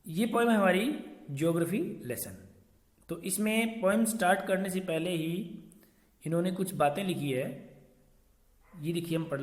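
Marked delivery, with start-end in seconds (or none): none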